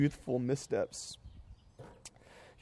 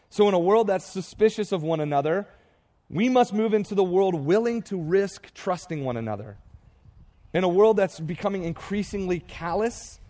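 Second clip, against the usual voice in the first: higher and louder, hammering each "in a world"; preaching at full force; 4 semitones higher, 4 dB louder than usual. Acted. Pastor rousing his church with the world refrain, preaching hard. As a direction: preach it with rising fervor, line after line.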